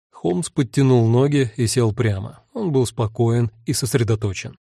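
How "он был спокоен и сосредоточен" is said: ordinary delivery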